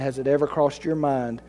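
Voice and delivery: God's gentle answer. Acted. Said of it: "And God might respond".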